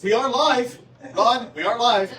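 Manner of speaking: high, loud reminder through laughter; loud laughing warning. Real, not acted. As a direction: call out a laughing warning to a colleague. Warns swearer they are streaming.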